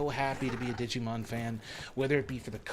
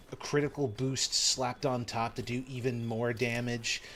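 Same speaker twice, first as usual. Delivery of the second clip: usual speaking voice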